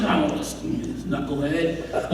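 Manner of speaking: low, quiet grumble under the breath; muted frustration. Acted. King grumbling about the soldier who disobeyed, muted frustration. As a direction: grumble it softly and slowly, exasperated. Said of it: "like, frustrated".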